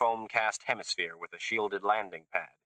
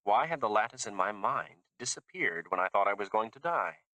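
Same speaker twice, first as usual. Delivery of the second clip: ordinary delivery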